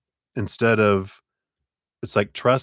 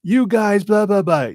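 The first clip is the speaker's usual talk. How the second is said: much higher and louder, a hammed-up ranting customer; mock angry caller; 7 dB louder than usual. Escalated caller ranting at a representative, a hammed-up high complaint. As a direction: squeal it like a caller losing it. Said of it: "When they say".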